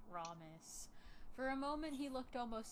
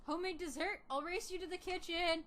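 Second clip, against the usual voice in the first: put-on eager child, a bit higher; excited kid voice; 7 semitones higher, 4 dB louder than usual. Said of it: "I'm gonna turn up the voice acting".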